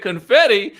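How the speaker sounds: loud, high, disbelieving; surprised. Retold or imitated. Recapper reacting to Rowan's answer, loud and surprised. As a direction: throw the word back in disbelief. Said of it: "I said".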